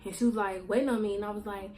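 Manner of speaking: ordinary talk, nothing marked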